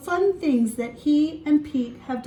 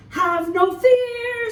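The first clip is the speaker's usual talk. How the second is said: superhero call; 10 semitones higher, 6 dB louder, slower than usual. Acted. Superhero cat announces himself.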